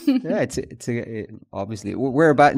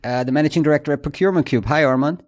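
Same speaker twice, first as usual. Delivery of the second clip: podcast host intro; 3 semitones higher, 5 dB louder than usual. Acted. Podcast intro over music.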